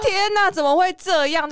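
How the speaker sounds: high half-laughing cry of disbelief. Retold or imitated. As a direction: laugh through mock dismay. Retelling shock at hospital news. (又笑又惊地喊)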